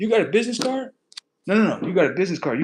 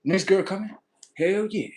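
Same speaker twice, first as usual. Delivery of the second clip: ordinary talk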